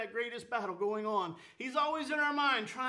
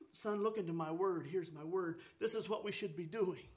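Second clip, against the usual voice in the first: lower, softly guiding a son; gentle; 5 semitones lower, 3 dB quieter than usual. Acted. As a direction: drop the pitch and coax gently. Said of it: "ever so gently will say".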